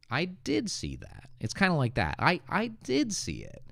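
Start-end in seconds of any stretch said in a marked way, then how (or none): 0.1-1.3 "did" pitched high in "I did see that"; stress on DID. Shown as a demonstration. Teacher showing emphatic past, "did" high and stressed. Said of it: "It's to stress that you did". 2.2-3.6 high, emphatic "did", falling on "see it"; stress on DID. Shown as a demonstration. Teacher showing how to insist, "did" high and stressed. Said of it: "It's to stress that you did".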